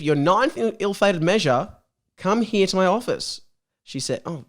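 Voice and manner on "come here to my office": ordinary talk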